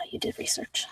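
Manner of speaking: usual speaking voice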